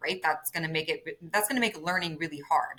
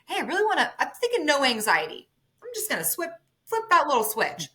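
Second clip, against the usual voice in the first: high, breezy imitated optimism; playfully voicing a wishful client; mock naive wish; 7 semitones higher than usual. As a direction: pretend it's as easy as that, pitched up.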